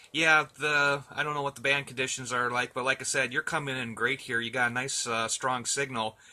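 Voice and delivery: normal talking voice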